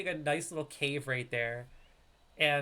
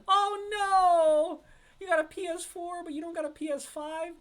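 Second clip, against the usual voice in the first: very high, loud teasing cry; mock dismay; 10 dB louder than usual. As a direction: wail in playful disbelief. Teasing viewer lacking a PS5.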